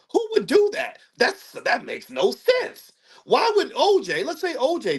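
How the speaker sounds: high-pitched, incredulous protest. Retold or imitated. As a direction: voice shoots up in mock disbelief. Voicing people's disbelief at the killing.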